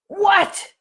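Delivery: put-on irritated teen snapping through the door; snotty teen what. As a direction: fire it back like an annoyed teenager at the door. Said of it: "in that tone of voice".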